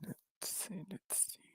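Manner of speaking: barely audible, whispered muttering; whispering to self. Real, not acted. Streamer to self, hunting the next character, whispering. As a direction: whisper it absently under your breath.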